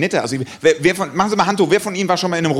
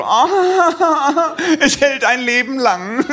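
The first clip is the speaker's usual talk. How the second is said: high, slow, gushing and laughing; mock lovestruck couple; 9 semitones higher, much slower than usual. Comedian mocking lovestruck couples at reception, gushing. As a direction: swoon like besotted newlyweds. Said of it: "nach dem Motto".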